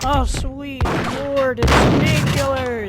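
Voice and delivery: slow, loud delighted exclamation; drawn-out joyful cry of discovery; excited. Real, not acted. Finds painkillers in the game.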